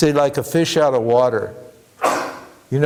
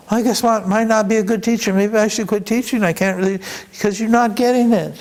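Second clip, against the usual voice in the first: higher-pitched, mildly hammed-up self-doubt; worried second-guessing, played for laughs; mock fretting; 8 semitones higher than usual. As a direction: lightly act out a teacher fretting over results. Voices a self-doubting fretting teacher.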